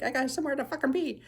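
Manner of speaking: a hint of an impatient alien in a rush; agitated alien. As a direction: hurry them along, annoyed. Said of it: "like, all agitated".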